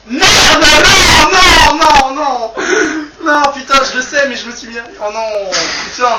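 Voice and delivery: loud high wail, fading to grumbling; despairing shout. Real, not acted. Actor losing the take near the end, loud despairing shout. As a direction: cry out at the ruined take.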